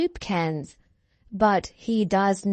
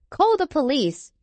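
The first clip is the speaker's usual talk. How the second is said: urgent, higher call for help; mild shout; 7 semitones higher than usual. A narrator's reading. Farmer calls for help for his pig, mild urgent shout. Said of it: "shouts the farmer".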